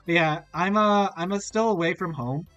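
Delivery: ordinary talk, nothing marked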